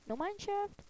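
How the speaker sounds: surprised high question. Real, not acted. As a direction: pitch jumps way up on shaft. Looking into a ravine.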